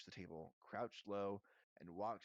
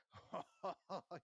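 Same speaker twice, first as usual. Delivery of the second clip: loud, higher belly laugh; hearty guffaw; 8 semitones higher, 3 dB louder than usual. A narrator's reading. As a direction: guffaw at your own joke. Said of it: "He gave a hearty guffaw".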